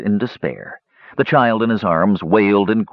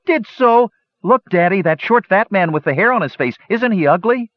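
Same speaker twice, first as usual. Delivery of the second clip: put-on very high, whiny child voices; shrill children's voices; 13 semitones higher, 3 dB louder than usual. A narrator's reading. Children pestering their warrior fathers, shrill. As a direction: pipe up like squabbling kids, pitched way up. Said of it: "filled with their shrill voices".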